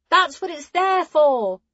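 high calling voice, slowing at end; calling after them. Acted. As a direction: call out after friends leaving. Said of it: "Hessa called after them".

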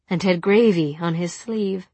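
usual speaking voice

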